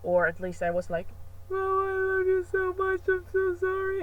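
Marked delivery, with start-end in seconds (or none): 1.5-4.0 high mock sobbing apology; much higher, hammed-up fake-crying plea; fake crying kid. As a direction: whine it out like a child faking tears. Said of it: "I started fake crying".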